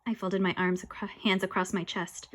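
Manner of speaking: usual speaking voice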